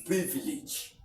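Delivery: "privilege" ending in a short "ij" sound; teacher's careful "privilege", ending "-ij". Shown as a demonstration. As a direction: say "privilege" with its last vowel as a light E. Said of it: "This one is".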